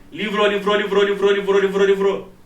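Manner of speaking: LIVRO fired off loud and fast on one pitch; rapid overlapping echo. Shown as a demonstration. Teacher showing echoes crowding in too soon, rapid loud chant. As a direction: chant LIVRO loudly, run together without gaps. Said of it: "se eu tenho uma situação assim".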